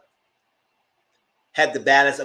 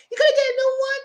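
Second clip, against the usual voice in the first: high, louder whining plea; exasperated whine; 6 dB louder, faster than usual. Retold or imitated. Exasperated retold reaction.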